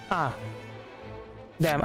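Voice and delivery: ordinary delivery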